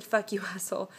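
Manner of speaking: reading swear words through a real laugh; amused laughing. Real, not acted. Reads a viewer's quarrel aloud and giggles at the swearing, laughing. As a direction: break into a laugh at the rude words. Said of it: "I'm laughing 'cause I'm saying the words".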